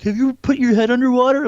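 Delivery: high, loud mocking kid voice; teasing cousin. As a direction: egg the little cousin on. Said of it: "he's like".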